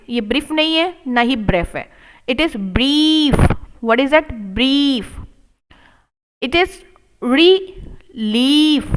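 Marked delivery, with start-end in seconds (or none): 2.3-3.3 brief slowed, ee held long; long ee sound. Shown as a demonstration. Teacher showing the long ee in brief. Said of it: "pressure देना आ जाएगा". 6.4-8.8 relief stretched slowly, ee held; long ee sound. Shown as a demonstration. Teacher showing the long ee in relief. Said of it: "pressure देना आ जाएगा".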